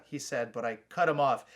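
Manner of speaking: usual speaking voice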